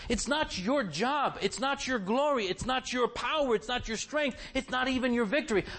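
rising preacher's insistence; emphatic preaching. Acted. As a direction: drive home "not your" line after line.

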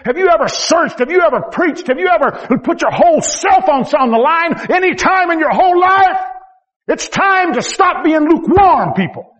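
high, pleading preacher's call; full-force sermon voice; fiery preaching. Acted. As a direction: drive the questions home.

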